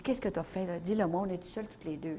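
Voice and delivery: husband asking quietly at night, hushed; hushed question. Retold or imitated. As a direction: ask it under your breath in the cabin. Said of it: "Mais il dit".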